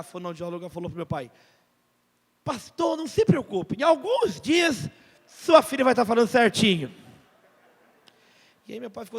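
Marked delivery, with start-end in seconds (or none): 2.4-7.0 loud reassuring. Retold or imitated. Speech therapist reassures the pastor.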